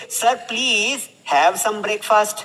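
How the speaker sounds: drawn out slowly like a teacher modelling; slow model phrase. Shown as a demonstration. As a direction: spell the sentence out slowly for students. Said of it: "तो आप क्या बोलोगे?".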